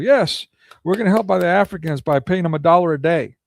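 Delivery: sneering imitation of a proud announcement; mock official voice. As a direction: put on a smug do-gooder tone to mock them. Mocking the UN's stated goal.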